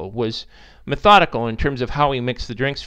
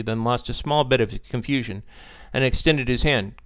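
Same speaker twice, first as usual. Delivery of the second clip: ordinary delivery, nothing marked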